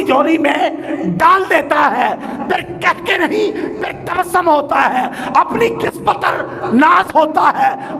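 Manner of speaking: high, driving sermon in short phrases; fervent preaching. Real, not acted. Preacher telling worshippers of joy in God's gift, fervent preaching.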